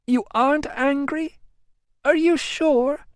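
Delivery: put-on high, plaintive young woman's wail; whiny Amelia. A narrator's reading. Amelia wailing to her cousin, high and plaintive. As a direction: wail it like a spoilt cousin begging pity.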